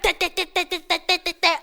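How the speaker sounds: rapid stuttered sprinkler spitting; mock spitting like a sprinkler; sprinkler imitation. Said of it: "He looked like a sprinkler".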